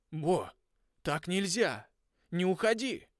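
high, loud, sobbing plea; crying out. Acted. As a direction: cry out in grief. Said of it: "Клифф тоже плакал". (плача, с отчаянием)

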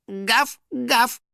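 short, high fake woofs; pretend dog bark. Finik pretending to be a dog, barking. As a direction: try to pass as a dog, high. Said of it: "попытался притвориться собакой".

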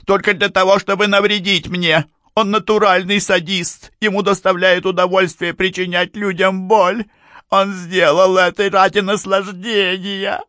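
very high, loud hysterical tirade; angry sobbing. A narrator's reading. Mrs. Amberley calls him a sadist, sobbing with rage. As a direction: wail the accusations at a sustained high pitch. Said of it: "всё так же сердито всхлипывая".

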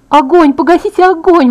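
loud, high panicked yell; screaming. A narrator's reading. Injured girl begging them to stop burning, screaming. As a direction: yell for help in burning pain. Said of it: "вопила я".

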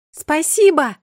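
high, loud grateful call. A narrator's reading. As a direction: shout thanks upward. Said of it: "крикнула я".

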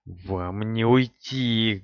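slow spitting snarl; angry spitting. Acted. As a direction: spit the words in rage. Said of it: "гневно, брызгая слюной, выпалил он".

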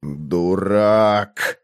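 long, low groan of dismay. A narrator's reading. Conan despairs at his stand-in's blunder, groaning. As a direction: groan the word out low and drawn. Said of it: "простонал Конан".

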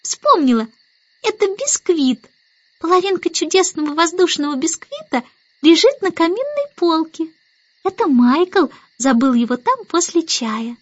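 put-on high little-girl exclamation, settling into explanation; excited cry. A narrator's reading. Baby Annabel remembers the biscuit, excited high cry. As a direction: burst out in a high child voice. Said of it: "Внезапно она вскрикнула".

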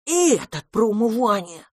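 high, exasperated little dragon; indignant. A narrator's reading. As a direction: protest like an outraged small child. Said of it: "возмутился дракончик".

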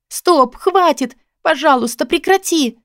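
high, pained pleading; crying out. A narrator's reading. Woman begging the man to stop the blows, crying out. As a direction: beg him to stop in high bursts. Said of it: "Снова мой крик разрезал тишину".

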